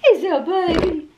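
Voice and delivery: high cooing. Real, not acted. Owner asking her dog, high and cooing. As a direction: ask the dog sweetly in a high voice.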